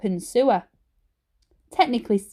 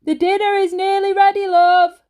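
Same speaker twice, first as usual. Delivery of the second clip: higher, louder call up the stairs; shout from downstairs; 10 semitones higher, 6 dB louder than usual. A narrator's reading. Dad calling upstairs, a loud shout. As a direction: call out to someone a floor away. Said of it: "came a shout from downstairs".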